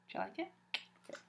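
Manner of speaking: usual speaking voice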